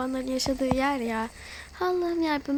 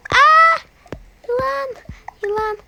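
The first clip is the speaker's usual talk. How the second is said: much higher, much louder panicked shriek; terrified scream; 10 semitones higher, 12 dB louder than usual. Real, not acted. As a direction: scream at the sight of a snake. Spots a snake. (yılanı görünce dehşetle çığlık)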